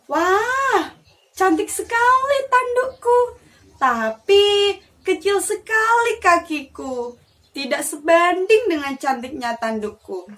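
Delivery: high, delighted deer voice; vain deer. Acted. Deer admiring his reflection in the river, high and vain. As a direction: swoon over your own antlers.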